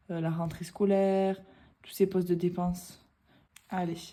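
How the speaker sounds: ordinary talk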